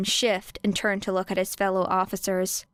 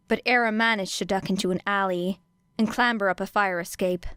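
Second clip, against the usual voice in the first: plain reading voice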